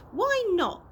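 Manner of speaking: put-on high indignant young girl's protest. A narrator's reading. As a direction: push back like a stunned girl. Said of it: "protested Chloe".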